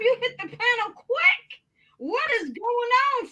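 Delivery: excited squeal to a friend; excited high greeting. Real, not acted. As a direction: greet him high and eager.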